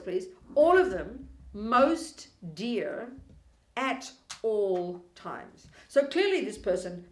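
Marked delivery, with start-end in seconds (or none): 0.5-5.7 every word drawn out very slowly; slow weighty reading. Shown as a demonstration. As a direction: let each word land, slow and heavy. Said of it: "hear the words".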